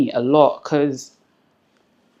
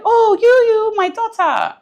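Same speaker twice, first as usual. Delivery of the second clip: much higher, fond mother's voice; imitating her mother; 5 dB louder, slower than usual. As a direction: lift up high like a fond old mother. Mother recognises daughter in photo.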